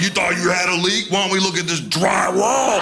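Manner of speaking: taunting mock plumber voice. Plumber imagined mocking the homeowner to his face. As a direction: sneer it like a schoolyard taunt.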